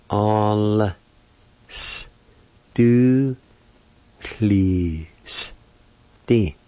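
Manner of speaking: "All s do please. D." with long gaps and a lone hissed s; syllable by syllable. Shown as a demonstration. Teacher pronouncing the word perfume, "All s do please. D." syllable by syllable. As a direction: separate every sound of "All s do please. D.". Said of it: "You pronounce the L sound with the A like this".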